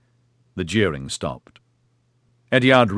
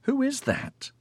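whiny; 5 dB quieter than usual. A narrator's reading. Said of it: "Eddard whined".

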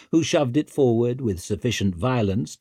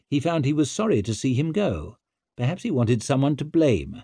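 ordinary delivery, nothing marked

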